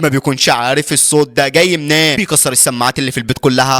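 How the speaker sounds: usual speaking voice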